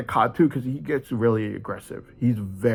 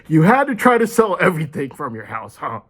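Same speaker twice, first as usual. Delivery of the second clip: higher and louder, teasing; sarcastic mock question; 5 semitones higher, 6 dB louder than usual. Telling police about the father, sarcastic raised jab.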